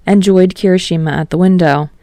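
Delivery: usual speaking voice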